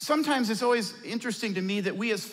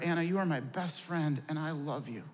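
soft, low and gentle to his daughter; tender whisper-soft love; 6 semitones lower, 6 dB quieter than usual. Retold or imitated. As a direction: say it quietly, full of love. Father softly tells daughter.

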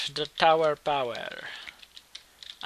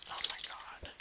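exhausted whisper; 13 dB quieter than usual. Real, not acted. Exhausted after win.

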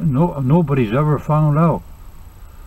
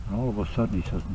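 ordinary talk

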